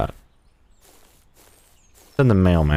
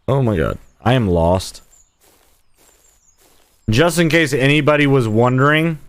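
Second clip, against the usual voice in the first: ordinary talk, nothing marked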